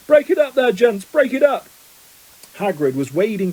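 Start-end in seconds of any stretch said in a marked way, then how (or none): 0.1-1.7 high, booming command; louder than all. A narrator's reading. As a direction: call out over a brawl. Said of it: "louder than all".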